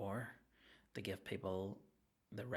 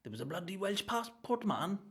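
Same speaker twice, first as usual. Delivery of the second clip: mock Welsh; 9 semitones higher than usual.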